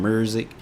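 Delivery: "music" said as "MUR-zick"; Memphis pronunciation of "music". Shown as a demonstration. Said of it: "how they say things out there".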